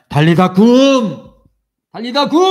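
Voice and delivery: very loud, slow ringing command; commanding call. Acted. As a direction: call the girl back to life. Jesus raises the girl. (크고 느리게 외치듯)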